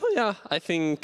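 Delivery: high voice cracking. Real, not acted. Player answering the host's prediction, voice cracking. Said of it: "your voice cracked".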